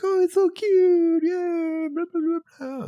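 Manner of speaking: put-on very high, squeaky cooing girl; girly high voice. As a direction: coo like a gushing girl. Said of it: "where they're like".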